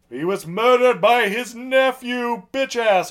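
high, loud, slow denouncing; dramatic accusation. Retold or imitated. Merrick denouncing the nephew, high, loud and slow. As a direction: proclaim it like an accuser before the court. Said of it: "and he's like".

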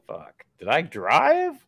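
retold groggy panic, dragged out; alarmed realization. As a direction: act out a slow, worried realization. Acting out his waking-up confusion.

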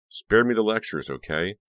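exasperated and loud; angry shout. A narrator's reading. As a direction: shout it down crossly, easing off at the end. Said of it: "Becca shouted down angrily".

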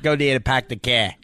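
hammed-up Boston accent with dropped r's; broad, joking Boston accent; bad Boston accent. Shown as a demonstration. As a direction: lay on a horrible Boston accent. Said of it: "Do you do a horrible Boston accent".

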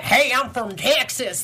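slow, heavy Texas drawl on every word; thick Texas accent. Retold or imitated. Co-host mocking the actor's now much stronger Texas accent. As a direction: lean hard into the Texas accent. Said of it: "it's just so much stronger".

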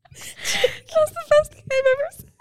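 high, strained through helpless laughter; squeezing words out while crying with laughter. Real, not acted. Proud of her own joke, laugh-crying.

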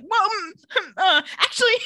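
high, loud mock stammering; hammed-up scrambling for words; flustered backpedaling. Mom backpedals in retold scene.

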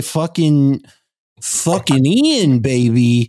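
drawn-out hammed-up Boston hype intro; mock Boston accent. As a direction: announce him like a Boston wrestler. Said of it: "that accent that I had".

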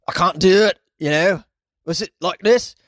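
high, loud attempt at a Scottish accent; bad Scottish accent. Shown as a demonstration. Host testing his Scottish for a listener, bad Scottish accent. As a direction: try a clumsy Scottish accent. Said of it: "my Scottish accent is just really, it's the pits".

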